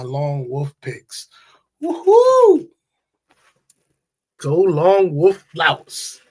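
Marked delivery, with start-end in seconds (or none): 1.8-2.7 loud, very high celebratory woohoo; excited whoop. Real, not acted. Host celebrating his winning picks, loud high whoop. 4.4-6.2 loud, drawn-out rallying cheer; playful self-cheer. Pretend. Host cheering his own winning picks, loud slow cheer.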